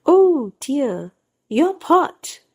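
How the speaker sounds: high mock-startled oh dear; exclaiming. A narrator's reading. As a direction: act caught out and jump up in pitch. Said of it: "exclaimed Missus Sheikh".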